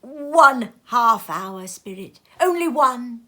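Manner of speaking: put-on high, loud, slow pleading old man; a desperate plea for half an hour; Scrooge begging. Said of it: "said Scrooge".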